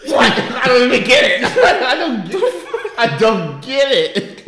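loud, high-pitched laughing outburst; cracking up, voice shooting up; laughing hard. Real, not acted. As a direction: shout it helplessly through laughter.

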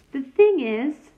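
put-on halting, slow start of a nervous boy; hesitant. A narrator's reading. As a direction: trail off unsure. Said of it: "she said kindly".

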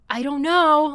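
loud bewildered I don't know. Retold or imitated. Guest telling the host she feels lost, loud baffled cry. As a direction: throw up your hands in loud confusion. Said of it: "I'm just like".

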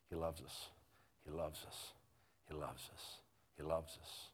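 soft, low repeated refrain; hushed sincerity. Real, not acted. Preacher giving his church the gospel, hushed and sincere. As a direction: let each one land quietly. The one simple thing, repeated.

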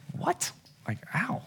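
very soft, barely voiced disbelief; hurt, hushed reaction. Dad hearing son won't live here, hushed hurt. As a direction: let it out tiny, as if wounded.

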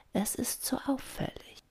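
high, soft whisper. A narrator's reading. Doña Rosa explains why she stopped jumping, whispering. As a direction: whisper it like something dangerous. Old dolphin confides in whisper.

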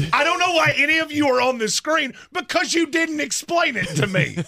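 high-pitched exasperated outburst; exasperated rant. Real, not acted. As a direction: let your voice shoot up in comic outrage. Exasperated rant about confusing episode.

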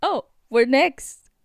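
loud, drawn-out eager quote; excited. Retold or imitated. Host retells waiting to board first, excited. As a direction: retell the thrill of being next up. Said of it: "we're excited 'cause they're like".

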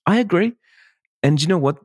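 big, high burst of agreement; eager agreement. Retold or imitated. Photographer eagerly agreeing with the mother of the bride, pitched high. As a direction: jump up in pitch, all in.

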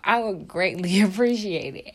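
playful laughing request; laughing plea. Pretend. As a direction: laugh through the thanks.

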